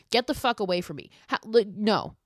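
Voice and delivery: ordinary talk, nothing marked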